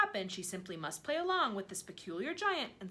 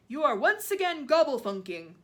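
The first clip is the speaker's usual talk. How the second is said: loud, higher scolding shout; giant shouting; 5 semitones higher, 11 dB louder than usual. A narrator's reading. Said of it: "the giant shouted".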